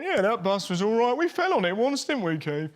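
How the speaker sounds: hammed-up frontman aside, laughing at the end. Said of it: "He went".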